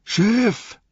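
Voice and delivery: very high, drawn-out yell; gebrülltes Rufen. A narrator's reading. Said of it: "brüllte noch einmal". (aus voller Brust hoch gerufen)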